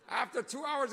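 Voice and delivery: usual speaking voice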